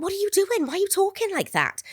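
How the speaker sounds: retold bewildered query; baffled questioning. Director questions the odd accent.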